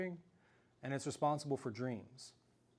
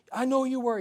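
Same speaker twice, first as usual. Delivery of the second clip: insistent yell; 10 semitones higher, 8 dB louder than usual. Retold or imitated. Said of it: "I was like".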